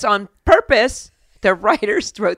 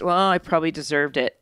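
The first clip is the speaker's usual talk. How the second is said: soft, slightly lowered quoted aside; under his breath; 5 semitones lower, 5 dB quieter than usual. Retold or imitated. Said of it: "hear that under his breath".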